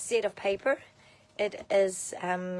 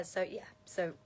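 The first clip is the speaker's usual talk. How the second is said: usual speaking voice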